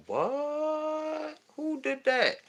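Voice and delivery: high, startled squeak of disbelief; shocked disbelief. Retold or imitated. Friend calls about the pier fire, high stunned disbelief. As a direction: caught off guard by the news, quietly. Retold shocked reaction to phone call.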